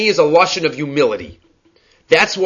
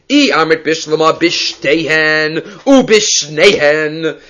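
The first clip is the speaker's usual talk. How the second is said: ordinary talk, nothing marked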